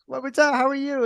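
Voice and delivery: very high, loud, beaming reply; mock-chipper answer to the neighbor; over-the-top fake cheer. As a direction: crank the fake happiness way up. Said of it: "And I'm like".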